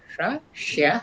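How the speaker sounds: সা then স্যা, the plain s then a palatal sh-like s; three s sounds. Shown as a demonstration. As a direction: contrast সা and স্যা clearly. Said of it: "তিনটে শকেই একটা উচ্চারণ করে". (সা আর স্যা দুই রকম স-এর উচ্চারণ)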